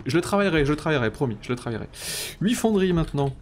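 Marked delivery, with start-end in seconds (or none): none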